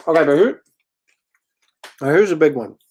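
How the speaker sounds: talking through a mouthful; chewing while he talks; mouth full. Real, not acted. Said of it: "Excuse me while I chew and talk".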